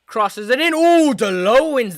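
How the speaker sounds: high swooping surprise on a header; animated football call, rising then settling; excited commentary. Real, not acted. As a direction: call the cross, then burst out amazed. Live call of corner header.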